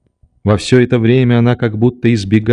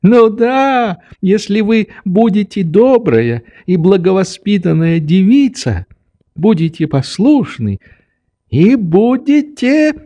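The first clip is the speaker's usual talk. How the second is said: put-on high, sing-song old man; indulgent old doctor; 10 semitones higher than usual. A narrator's reading. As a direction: list the conditions, stretching the last words high.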